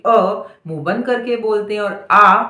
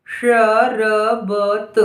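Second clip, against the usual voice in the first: very slow dictation; syllable by syllable; much slower than usual. Shown as a demonstration. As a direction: stretch शरबत into four separate sounds. Dictating word sound by sound.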